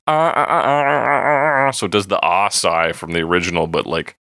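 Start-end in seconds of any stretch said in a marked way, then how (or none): 0.1-1.7 higher, chopped-up "ah" repeats; staccato synth imitation. Podcaster showing co-host the chopped-up synth, staccato vocal imitation. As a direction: chop the ahs like a staccato synth. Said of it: "kinda does this staccato".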